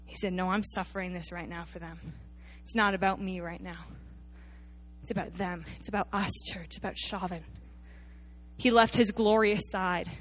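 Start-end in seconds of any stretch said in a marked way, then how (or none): 0.3-7.5 softer, low and tender, giving himself up; a quiet, sincere sacrifice; tender Jesus. A narrator's reading. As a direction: speak it gently as one suffering for others. Jesus quoted on the cross.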